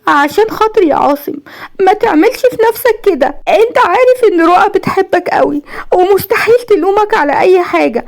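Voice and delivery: high, tearful woman's plea; choked-up pleading. A narrator's reading. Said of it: "وهي بتقول بصوت مخنوق". (بصوت مخنوق بالعياط)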